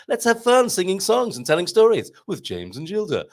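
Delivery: put-on high, jolly kids' show host; children's program presenter. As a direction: chirp it to an audience of small children. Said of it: "Sounds like a children's program".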